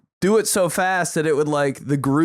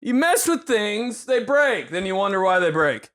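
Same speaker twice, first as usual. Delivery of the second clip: mock dad lecture; 6 semitones higher than usual. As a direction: lecture like an exasperated father. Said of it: "Holy shit".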